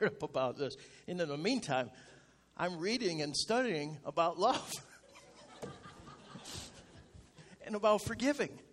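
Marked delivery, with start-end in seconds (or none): 5.6-6.8 sudden high airy laugh at himself; high breathy laugh. Real, not acted. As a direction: let a squeaky laugh escape. Laughs at his own stubbornness.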